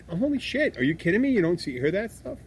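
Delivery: high, incredulous retort; mock disbelief. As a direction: react in comic exasperated amazement. Acted disbelief at her accent denial.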